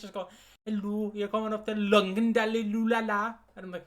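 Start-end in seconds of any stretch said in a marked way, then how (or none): 0.6-3.3 sing-song mock Welsh announcer, raised pitch; loud, lilting Welsh tannoy parody; Welsh train announcer. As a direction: babble the place name like a Welsh announcer. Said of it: "the fucking guy ... on the speakers".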